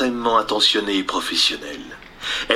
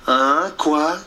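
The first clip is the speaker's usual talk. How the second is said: voix de garçon, plus aiguë, confuse; garçon mal réveillé; 6 semitones higher, slower than usual. Acted. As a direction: se réveiller en sursaut. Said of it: "demanda le numéro deux". (Jean mal réveillé)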